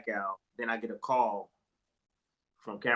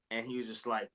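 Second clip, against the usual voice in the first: ordinary talk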